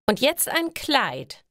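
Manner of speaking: deutlich höher, fordernd wie ein verwöhntes Mädchen; herrische Ingrid. Acted. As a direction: befiehl es von oben herab, hohe Stimme. (hoch und herrisch, Ingrid kommandiert ihre Freundin)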